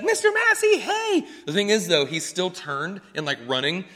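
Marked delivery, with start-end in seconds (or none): 0.0-1.3 put-on high, excited little boy's call; excited kid calling. First grader Aiden spotting his PE teacher, high excited call. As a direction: squeal it out like an eager first grader. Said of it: "he's like".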